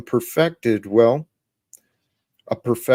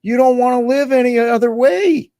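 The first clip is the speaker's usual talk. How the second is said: high-pitched mock lecture to one's former self; talking to old self; 7 semitones higher than usual. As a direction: start high, climb higher, then ease back down. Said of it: "Talking to my old self".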